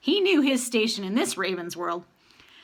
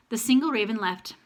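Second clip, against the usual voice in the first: ordinary delivery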